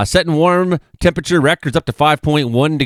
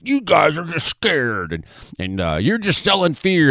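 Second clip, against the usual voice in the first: mock quote; 5 semitones higher than usual.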